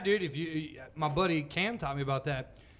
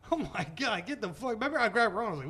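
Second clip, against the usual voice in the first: normal talking voice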